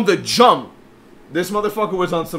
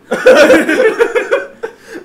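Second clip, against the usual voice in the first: loud, high, unrestrained laugh; loud laughter; 12 dB louder than usual. Real, not acted. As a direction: burst out laughing hard. Friends laughing at the story.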